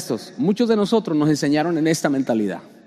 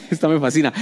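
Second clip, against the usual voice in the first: ordinary talk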